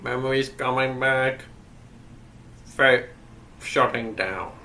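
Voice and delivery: slow, even robotic monotone. Acted. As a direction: speak like a machine powering down. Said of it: "doing the robot voice".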